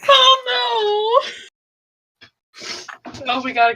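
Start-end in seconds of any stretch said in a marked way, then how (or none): 0.1-1.3 high-pitched playful wail; mock crying. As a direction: squeal it as if about to cry, hammed-up. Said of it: "I'm gonna cry".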